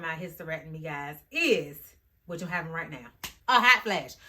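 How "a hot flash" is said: loud, high exclamation; sudden loud cry of heat; hot flash. Real, not acted. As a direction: announce it with a jolt of alarm. Announcing her hot flash.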